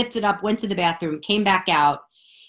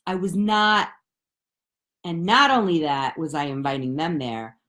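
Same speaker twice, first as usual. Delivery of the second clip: ordinary talk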